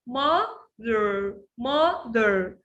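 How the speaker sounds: "mother" broken slowly into mo-ther; split into syllables. Shown as a demonstration. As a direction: say "mother" in two separate beats. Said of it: "I have many syllables".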